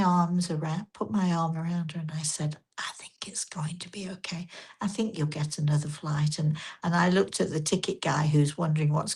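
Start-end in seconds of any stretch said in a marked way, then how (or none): 2.8-6.4 near-whispered, soothing; gentle, quiet reassurance. Retold or imitated. Woman with an arm round an upset traveller, hushed reassurance. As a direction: lower and much softer, comforting her. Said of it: "very gently said to her".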